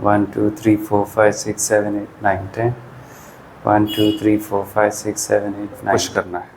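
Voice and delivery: steady, low counting that fades out toward the end of each set; exercise count. Shown as a demonstration. Physio counting viewers through an isometric wall push, steady count. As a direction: count the hold out evenly, like a physio.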